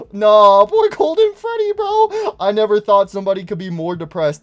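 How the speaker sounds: high pitched aww; squeaky pity. Real, not acted. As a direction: squeal with sympathy. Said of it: "sympathy outburst".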